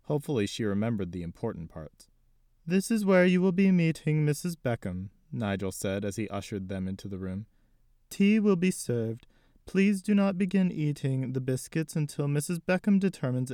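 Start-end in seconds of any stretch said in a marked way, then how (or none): 2.7-5.1 high, formal butler voice. Acted. Butler Nigel speaks. 8.1-13.5 high, formal butler voice. Acted. Butler Nigel continues.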